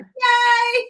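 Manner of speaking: put-on high, loud gleeful yay like a little boy; excited kid cheer. Little boy cheering at his dinosaur cartoon, high and loud. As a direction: cheer it like a delighted child. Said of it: "And he's like".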